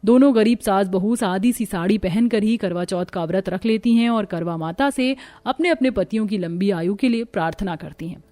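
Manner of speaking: ordinary delivery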